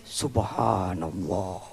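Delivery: slow, hushed, low Subhanallah; quiet reverent awe; awed praise. Real, not acted. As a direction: breathe out wonder, drawing it out.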